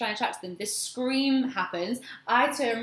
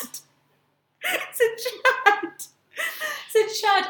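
laughing; 13 semitones higher, 5 dB louder, much slower than usual. Acted. Mocking repeats of name.

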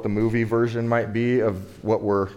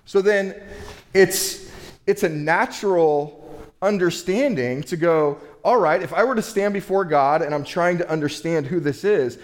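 rising preaching intensity; 6 semitones higher than usual. Real, not acted. Preacher to congregation, imagining justifying himself, in a fervent, raised voice. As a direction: build the plea with fervor before God.

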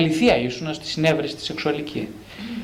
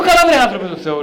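laughing, louder retort; laughing exasperation; 3 semitones higher, 7 dB louder than usual. Retold or imitated. Priest retelling his reply to a client, laughing. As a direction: laugh out the rebuke. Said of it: "Λέω".

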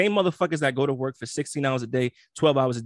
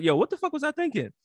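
mock realization; 12 semitones higher than usual. Retold own sudden realization.

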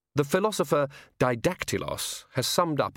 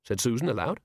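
ordinary delivery, nothing marked

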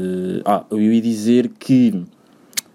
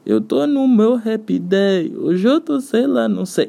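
sing-song happy; 10 semitones higher, much slower than usual. Real, not acted.

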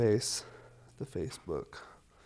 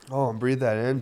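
usual speaking voice

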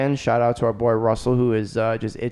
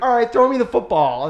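teasing dad; 10 semitones higher, 3 dB louder than usual. Retold or imitated. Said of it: "And he'd be like".

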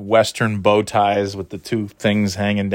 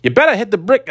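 higher, more pushed tough guy threat; retry of the Jackie Daytona line; 12 semitones higher, 4 dB louder, faster than usual. Shown as a demonstration. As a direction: try the threat again, reaching higher. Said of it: "I sounded better than that".

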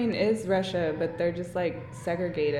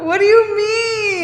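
much higher, louder disbelief; can't believe she asked that; incredulous; 13 semitones higher, 11 dB louder than usual. Real, not acted. Baffled at friend's question.

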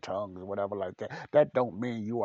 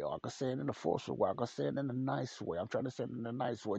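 ordinary talk

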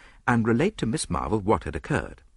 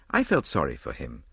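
plain reading voice

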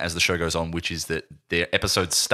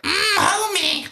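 much higher, slow cartoon voice; cartoon wife impression; 3 dB louder, slower than usual. Host imitates a Simpsons character for friends, high cartoon voice. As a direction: squeeze it up into a cartoon mum. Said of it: "There's this character who's like".

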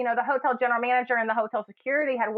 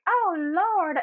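high startled Oh Lord; shocked gasp; 8 semitones higher than usual. Retold or imitated. Bartender gasping at the couple kissing. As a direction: gasp it out, clutching pearls.

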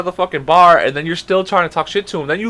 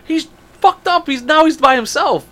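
high, strained mock rant; retold exasperation; 13 semitones higher than usual. Teller scolds friend who let the drunk escape, mock exasperated rant. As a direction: act out frantic frustration, pitch way up.

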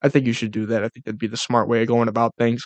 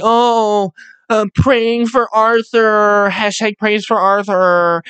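slow, higher, sappy fake-caring voice; mock sympathy; 7 semitones higher, much slower than usual. Mocking online commenters, syrupy fake sympathy. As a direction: drag out the fake concern. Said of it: "everybody's like".

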